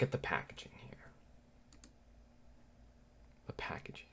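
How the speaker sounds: quick hushed whisper. Real, not acted. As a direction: whisper it as you lean in to look. Leaning in to inspect packaging.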